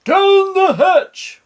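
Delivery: very high, slow loud cheer; rowdy crew toast; crew shout. A narrator's reading. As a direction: roar it back like a pirate crew. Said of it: "we all shouted".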